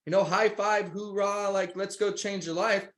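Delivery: mock pep talk. Business partner imitating a morning motivational call, mock pep talk. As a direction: pretend to rally someone with over-eager, high-pitched hype. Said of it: "I'm not calling Ted in the morning, like".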